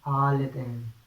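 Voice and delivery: ordinary talk, nothing marked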